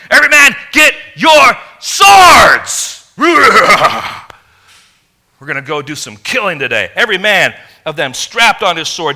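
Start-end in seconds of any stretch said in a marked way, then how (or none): 0.1-6.9 furious war shout. Retold or imitated. David ordering his men to arm, furious shout. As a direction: roar it out, blood boiling for revenge. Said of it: "It was like".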